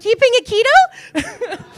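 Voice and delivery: high, loud, slow yell with a laugh; loud comic call-out. Acted. Server calling out dish after awkward eye contact, loud and high.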